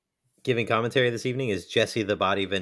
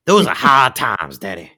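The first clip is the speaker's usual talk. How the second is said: wrestling promo; 3 semitones lower, 8 dB louder than usual. Pretend. Mock wrestling promo continues.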